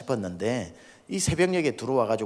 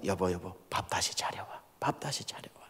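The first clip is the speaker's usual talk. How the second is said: soft whisper to his wife; hushed request; 6 semitones lower, 11 dB quieter than usual. Retold or imitated. Pastor asking his wife to set the table again, soft whisper. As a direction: murmur it gently so the brother won't wake.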